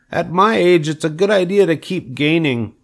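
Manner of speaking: high piglet voice; Wilbur. A narrator's reading. Wilbur tells the sheep about growing, high piglet voice. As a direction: speak up in a high, young pig's voice. Pig character talks to sheep.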